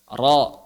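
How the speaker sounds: "Ra" heavy, with a backed, o-like vowel; thick ra. Shown as a demonstration. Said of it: "Begitu misalnya".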